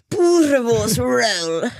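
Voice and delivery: very slow, hammed-up South African attempt; exaggerated South African imitation; imitating South African accent. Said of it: "I'll try to imitate you".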